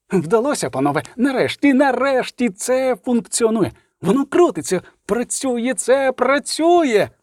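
high, thrilled cries; ecstatic professor. Acted. Oppenhauser announcing his machine works, ecstatic and high. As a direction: squeal with triumph.

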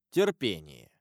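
low, grave voice that makes the dying tremble; ominous abbot. A narrator's reading. Abbot warns the dying Caderousse, low and grave. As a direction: drop low and let Терпение chill him. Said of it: "голосом, от которого умирающий затрепетал".

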